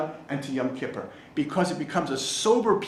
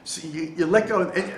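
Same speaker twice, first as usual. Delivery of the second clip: normal talking voice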